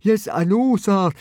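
a hint of Fishlegs stammering. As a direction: trip over the words. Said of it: "stammered Fishlegs".

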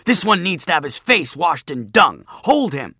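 loud, high and furious; angry bully's order. Acted. Bully turning on his laughing friends, angry loud command. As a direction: order your gang about in a temper. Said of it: "spun on them like an angry tiger".

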